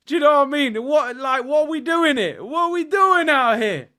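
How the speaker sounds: high, loud disbelief at the comparison; exasperated high rant. Real, not acted. Streamer ranting to chat, high and exasperated. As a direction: throw your hands up, voice climbing.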